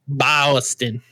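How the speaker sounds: "Boston" with broad drawn vowel, Boston accent. Shown as a demonstration. Friend tests the vowel in a Boston accent. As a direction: try local Boston pronunciation of "Boston". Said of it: "But it's a, it's a ah".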